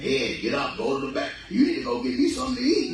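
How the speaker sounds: the belly ordering him around; demanding stomach; stomach talking. Acted. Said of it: "these conversations we be having".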